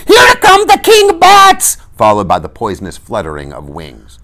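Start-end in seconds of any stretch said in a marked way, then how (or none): 0.1-1.8 very high, slow shrieked warning; piercing, drawn-out panicked shriek; mother's scream. A narrator's reading. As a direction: scream it in terror at top pitch. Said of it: "heard their mother scream".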